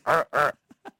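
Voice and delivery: a low put-on Tim Allen grunt. Caller repeating his Tim Allen grunt for the host. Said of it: "That's my version of what he says there".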